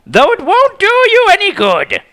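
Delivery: put-on very high, loud, gloating girl; gloating villain. A narrator's reading. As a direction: taunt them from above.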